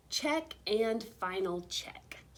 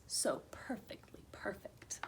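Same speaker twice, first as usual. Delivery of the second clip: breathy whispered refrain; 10 dB quieter than usual. A narrator's reading. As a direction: drop to a secret whisper. Whispered refrain in read-aloud story.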